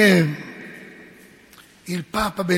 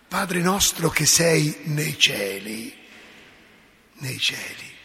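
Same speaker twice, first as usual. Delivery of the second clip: slow prayer; 3 semitones lower, slower than usual. Shown as a demonstration. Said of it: "non è che Dio sta su una nuvoletta".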